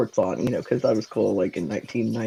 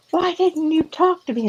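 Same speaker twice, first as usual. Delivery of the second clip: imitating her mom, much higher pitched; mock a tearful mother's plea, pitched up high; mom upset; 14 semitones higher than usual. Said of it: "and she started crying".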